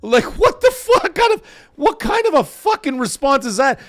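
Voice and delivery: loud, high, incredulous outburst; shocked outrage. Retold or imitated. Streamer recalling a rude chat comment, shocked outrage. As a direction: replay being stunned and affronted, pitch jumping up.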